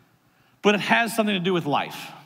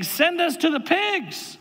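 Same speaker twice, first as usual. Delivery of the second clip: stretched, insistent begging in a reading; 10 semitones higher than usual. A narrator's reading. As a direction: voice the demons pleading, pitched way up. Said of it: "they begged him, saying".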